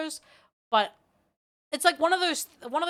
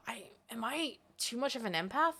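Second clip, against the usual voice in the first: whispered aside to herself; whispering; 7 dB quieter than usual. Real, not acted. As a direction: whisper it under the breath. Unvoiced aside to self.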